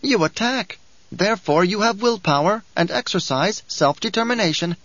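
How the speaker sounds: a sly little dwarf preaching the uses of war; sly dwarf Bijaz. Acted. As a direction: lecture like a gleeful little schemer. Dwarf Bijaz goads Hayt.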